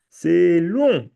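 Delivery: "long" drawn out, high and loud; stress on long. Real, not acted. Teacher warning students about fifty questions, "long" stretched loudly. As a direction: stretch and punch the word "long".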